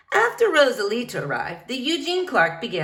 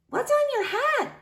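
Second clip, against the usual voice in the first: put-on very high, curious child; little girl voice. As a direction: ask like a small girl.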